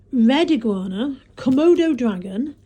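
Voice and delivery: usual speaking voice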